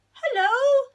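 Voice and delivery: very high, loud squeaky hello; a big bear faking a tiny bee voice; Norman's hello. Acted. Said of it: "said Norman".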